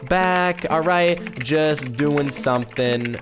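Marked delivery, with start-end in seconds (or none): 0.1-0.7 slow, stretched-out words; typing aloud. Real, not acted. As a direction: draw each word out as you type it. Reading out a chat message while typing.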